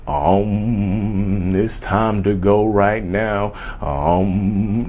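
slow, hushed, mock-serene advice; slowed-down fake zen counsellor voice; mock guru calm. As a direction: parody a soothing meditation teacher. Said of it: "meditating with y'all and".

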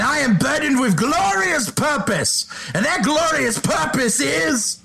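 high, slow grandiose villain voice; booming arrogant alien overlord. Pretend. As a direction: proclaim it like a vain trickster god. Loki quote in character.

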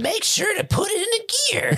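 high, whispered cartoon pup voice; cartoon dog voice. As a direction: hiss it like a kids' show puppy. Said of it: "you sound like a Paw Patrol".

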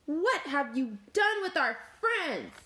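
high, swooping outcry; dramatic alarmed question; horrified accusation. A narrator's reading. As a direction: demand it in shock. Horrified animal confronts the wolf.

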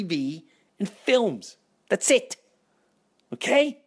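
high-pitched defensive insistence; insistent protest. Retold or imitated. Insisting to Phil, rising.

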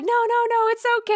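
high-pitched mock consoling voice; retold reassurance. The girl calms the storyteller in the car, high mock soothing. Acts out friend consoling near-crying teller.

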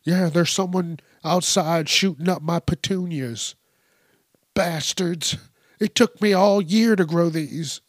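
put-on fussy old woman mourning her flowers; old lady griping to the dispatcher about her petunias; upset old lady. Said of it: "they called the cops and were like".

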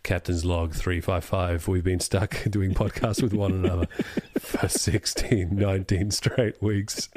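slow, low captain dictating a log; low, measured log entry; mock captain's log. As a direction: intone a pretend captain's log with chuckles.

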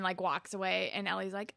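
ordinary talk, nothing marked

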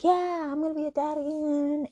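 put-on excited dad-to-be voice; mock excited. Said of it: "he's all excited".

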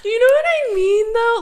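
high, loud, exaggerated Valley Girl voice; obnoxious Valley Girl. Co-host mocking worse accents to her friend, obnoxious Valley Girl. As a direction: whine it like an over-the-top Valley Girl. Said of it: "Like an obnoxious Valley Girl accent".

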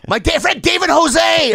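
high, loud, drawn-out retort; heated mock shout. Narrator inventing dead friend, heated shout. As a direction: yell it as if in a fight. Acted quarrel, shouted lie.

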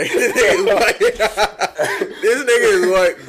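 loud, high laughing taunt; laughing teasing. Real, not acted. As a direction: crack up while roasting him.

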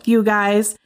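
usual speaking voice

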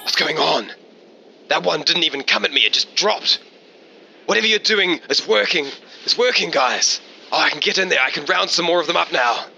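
high, excited radio chatter; Trevor. Acted. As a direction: report eagerly over the radio. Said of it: "you hear over the radio from Trevor".

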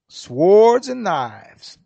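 Swords and knives, each word drawn out, slow and loud; slow emphatic. Real, not acted. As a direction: lean hard on Swords and knives. Emphatic point.

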